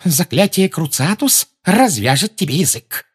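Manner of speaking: plain reading voice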